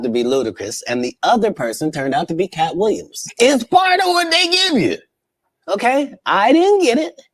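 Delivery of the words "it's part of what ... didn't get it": squeaky high-pitched put-on voice; lightly voiced high character; high mock voice. Comic high voice in a reacted clip.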